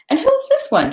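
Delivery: very high, bright questioning voice; curious hostess. Acted. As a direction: ask it up high, amused. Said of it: "she said, addressing her governess".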